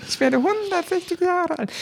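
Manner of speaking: high, wailing ghost voice; ghostly wail. Pretend. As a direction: quaver like an ancient ghost. Continuing the ghost voice bit. (hohe, klagende Geisterstimme)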